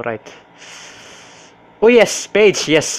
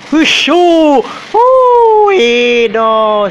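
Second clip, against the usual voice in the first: terrified screams; 10 semitones higher, 7 dB louder, much slower than usual. Real, not acted. Slenderman catches player.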